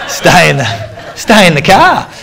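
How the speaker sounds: high, loud words broken by laughter; laughing quote. Retold or imitated. Telling a story of his coach refusing a lift, laughing. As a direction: laugh through the coach's order.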